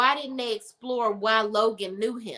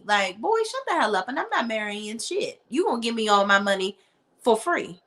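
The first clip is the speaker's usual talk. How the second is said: ordinary talk, nothing marked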